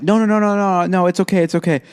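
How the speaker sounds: high-pitched, hurried string of refusals; imitated nervous refusal. Boyfriend retelling Liza refusing to meet her idol, high nervous protest. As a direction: mimic someone anxiously backing out, pitched well up.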